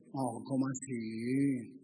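lento, bajo y suave; un oh incrédulo y arrastrado; asombro fingido. Acted. (¿cómo así? lento y en voz baja)

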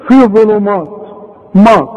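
imitated mistake, "مات" with a thick dark vowel; wrong heavy مات. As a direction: mimic a learner making "مات" in ظلمات heavy. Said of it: "وبرضه تيجي تاني وتقول لي". (تقليد الغلط: "مات" مفخمة)

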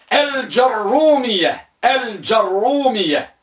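الجرومية as al-jar-rumiyya, hamza dropped, ر doubled; second vocalization. Shown as a demonstration. Teacher dictating the second type, carefully drawn out. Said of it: "بحذف الهمزة".

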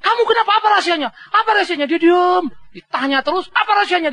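high, insistent asking; pressing question. Retold or imitated. Soldiers pressing the hero, high and insistent. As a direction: keep pushing for the secret.

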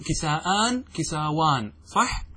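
usual speaking voice

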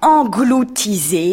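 en-glou-ti-sés said slowly, each syllable apart; slow, spelled-out engloutisés. A narrator's reading. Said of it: "en divisant le mot en syllabes".

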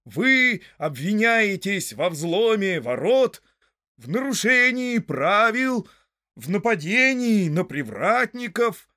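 loud, high-pitched, drawn-out list of charges; solemn proclamation. A narrator's reading. Sheriff chief reads the charges to Frodo, pompous and loud. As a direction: read out charges grandly and slowly. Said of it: "торжественно произнёс начальник шерифов".

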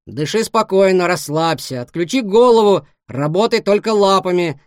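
loud, firm swimming coach; coaching instructions. Acted. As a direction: call the instructions out across the water. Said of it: "наставлял его Вениамин".

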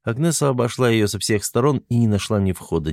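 ordinary delivery

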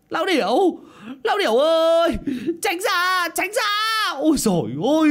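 loud, very high warning yells; throat-tearing scream. A narrator's reading. Coachman yelling at the distracted old man, screaming frantically. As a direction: holler desperately to get him out of the way. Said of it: "Gã phu xe gào đến rạc cả họng".

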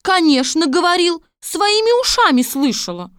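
high, loud confirmation; emphatic servant woman. Acted. Servant woman backing the princess's claim, high and loud. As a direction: pipe up loudly as witness.

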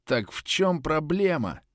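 mumbling through a mouthful; words thick and slurred after a beating; slurred speech. A narrator's reading. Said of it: "Слова у меня получались невнятными".

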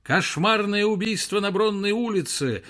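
high hawker's call; newsboy cry. Acted. Newsboys howling headlines in street. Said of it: "завывали неестественные сиплые голоса".

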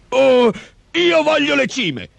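high, drawn-out cluck of a big man; clucking ogre. A narrator's reading. Big man choosing his half of the harvest, clucking. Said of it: "chiocciò l'omone".

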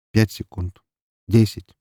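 usual speaking voice

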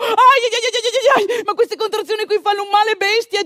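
loud, high ai ai of contraction pain; woman in labour crying out at contractions; pain cries. Retold or imitated. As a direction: cry out through the contraction. Labour pains return.

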